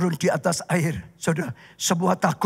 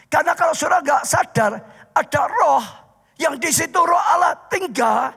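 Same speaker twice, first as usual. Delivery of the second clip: preaching at full force; 9 semitones higher than usual. Acted. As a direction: drive the story home to the congregation. Preaching about the fire within.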